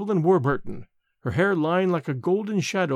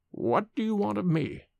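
hollow words; 4 semitones higher, 4 dB quieter than usual. A narrator's reading. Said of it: "each word struck hollow".

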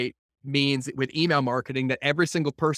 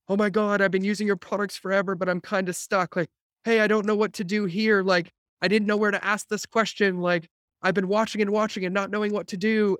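higher-pitched eager customer voice; retold pleading follower, pitched up; imitated customer; 6 semitones higher than usual. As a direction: pour it out like a stuck fan finally asked. Voices customers' grateful stuck messages.

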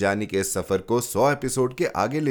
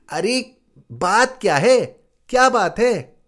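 much higher, louder, worried aunt's voice; alarmed aunt; 11 semitones higher, 5 dB louder than usual. Acted. Aunt anxiously demands what is happening, high and loud. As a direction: press anxiously for what's wrong. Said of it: "बुआ ने कहा".

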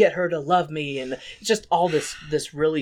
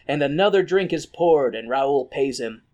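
usual speaking voice

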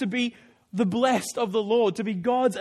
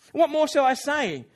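a touch louder, declaiming reading; scripture reading; 3 semitones higher, 4 dB louder than usual. Acted. Preacher reads Hebrews to congregation, declaiming. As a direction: read the verse with lift.

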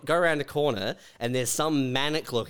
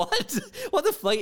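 1 shocked outburst. Pretend. Imagined shopper shocked by car.

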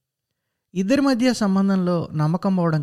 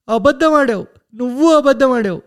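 higher, louder hammering accusation; angry accusing shout; 6 semitones higher, 6 dB louder than usual. Acted. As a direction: hurl the accusation at him. Mother shouting at lying son. (కోపంగా అరుస్తూ నిందించడం)

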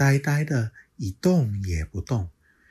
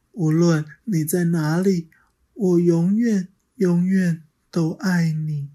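tender farewell; 9 semitones higher, 4 dB louder than usual. Acted. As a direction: speak to a child who is gone.

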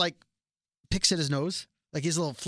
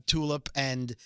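ordinary talk, nothing marked

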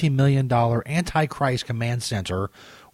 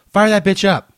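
shouted order, raised pitch; loud command; 6 semitones higher, 6 dB louder than usual. Acted. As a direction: bark it out to the crew. Ordering the command center switched on.

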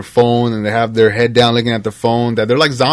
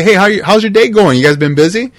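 excited greeting; 9 semitones higher, 5 dB louder than usual. Retold or imitated. Acting out Chipotle greeting.

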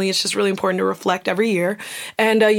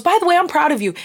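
higher, breezy mock compliment; casual affection; 7 semitones higher, faster than usual. Normal person praising a sibling, imitated easy cheer. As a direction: toss it off as if it's easy. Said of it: "the normal person that goes".

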